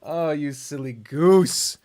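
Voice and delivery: silly goose. Real, not acted. Teasing the dog.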